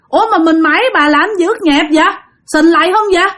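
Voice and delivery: high-pitched, bewildered husband roused from sleep; startled awake. Acted. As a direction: protest in puzzled, high-pitched questions. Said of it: "ông ngoại của bạn giật mình liền".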